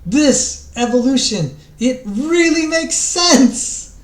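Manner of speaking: high, loud and slow, beaming with discovery; exulting. Retold or imitated. Creationist student tells his lecturer, exulting slowly and high. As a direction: savour each word like a thrilled convert. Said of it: "he exulted".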